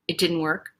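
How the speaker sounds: low, soft mock letdown; deflated father. Daughter mimicking dad whose tantrum failed, subdued. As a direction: imitate a crestfallen, subdued dad. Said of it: "think of my dad going".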